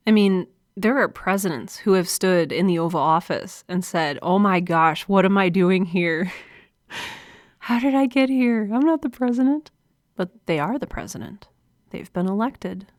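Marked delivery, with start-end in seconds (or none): 4.2-9.7 mock panic. New president in the Oval Office, mock panicked self-doubt.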